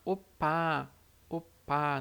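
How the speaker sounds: opá with a stronger, tense pp sound; teacher's slow demo of opá with a firm tense p; tense doubled p. Shown as a demonstration. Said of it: "é um som de P um pouquinho mais forte".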